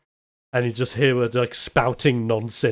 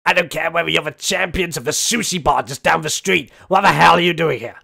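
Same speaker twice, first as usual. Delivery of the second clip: put-on louder, higher scolding old-lady voice; angry old lady; 6 semitones higher, 6 dB louder than usual. Grandma yelling at the strangers in her kitchen, furious. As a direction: scold them while waving the spoon. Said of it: "points it towards you and just goes".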